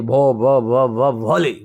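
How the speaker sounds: low, halting struggle through "भो" to "भोली"; stammering. Acted. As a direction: stutter "भो" several times, then get out "भोली". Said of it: "नाम बताऊंगी तो फिर हकलाऊंगी".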